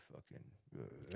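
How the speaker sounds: low, slow, hushed growl of a dad; imitating an angry dad keeping it low. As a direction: drop the voice right down, barely audible. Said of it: "lower his tone of voice".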